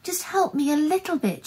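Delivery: high-pitched, desperate begging; anxious high plea; pleading. A narrator's reading. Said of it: "she pleaded".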